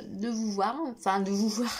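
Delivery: ordinary talk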